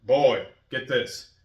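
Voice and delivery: gruff warrior dad scolding his son; imitating Kratos scolding his boy. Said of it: "he just says".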